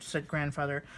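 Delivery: lively storybook narration, voicing each bear; reading to children. A narrator's reading. Reading Little Bear's Visit to viewers, warm storybook narration. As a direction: read it aloud like a picture book at bedtime. Reads a children's book aloud.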